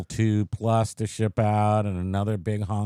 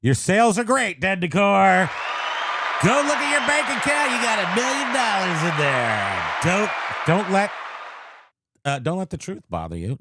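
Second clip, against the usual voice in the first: mock hype; 9 semitones higher than usual. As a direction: pump him up like a huckster. Mock hype pep talk to viewer.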